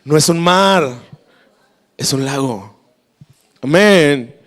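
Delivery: ordinary talk, nothing marked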